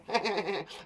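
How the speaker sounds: rapid chattering noise; quick put-on tittering; imitated chatter. Shown as a demonstration. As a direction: chatter like little birds. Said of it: "Have a little chatter".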